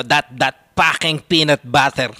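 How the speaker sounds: put-on loud peanut-butter rant in a Filipino accent; Filipino English accent. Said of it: "Filipino English".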